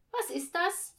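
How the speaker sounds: put-on high, loud child voice; brash kid's question. A narrator's reading. Children interrupt the reading at an unknown word, loud and high. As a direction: pipe up bluntly.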